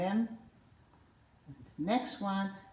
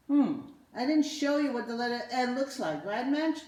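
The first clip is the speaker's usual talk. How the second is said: high, louder puppet voice; a playful character asking its friend; 6 semitones higher, 4 dB louder than usual. Acted. Puppet asking Mister Mensch, high and bright.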